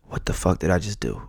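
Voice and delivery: low, soft, sobered self-questioning; hushed regret. Retold or imitated. Host recalling himself after blowing up, quietly regretful. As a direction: drop right down as if coming to after an outburst.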